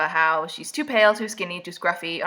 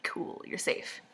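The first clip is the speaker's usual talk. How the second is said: whispered deadpan reassurance; 13 dB quieter, slower than usual. Retold or imitated. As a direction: toss it off, flat and breathy. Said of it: "and I'm like".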